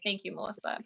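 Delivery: softly spoken awed thanks; hushed gratitude. Retold or imitated. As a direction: breathe the thanks almost under your breath. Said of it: "And I was like".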